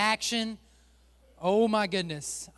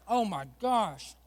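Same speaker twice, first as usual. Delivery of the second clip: disgusted groan; slower than usual. Real, not acted. Still tasting the dead fish.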